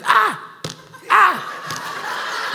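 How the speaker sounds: son imitating dad's high, loud cry, with a laugh; mock yell. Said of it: "And Daddy was like".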